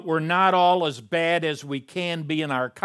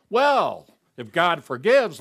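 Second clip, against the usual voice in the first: higher, louder glib excuse; mock objector; 4 semitones higher, 6 dB louder than usual. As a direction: voice the one who wants to keep sinning. Voicing a sinful objector.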